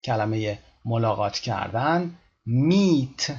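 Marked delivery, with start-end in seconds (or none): 2.5-3.3 "meet" with a drawn-out ee, pitched higher; teacher's raised model "meet", long vowel; long stretched ee. Shown as a demonstration. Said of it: "به همین شکل".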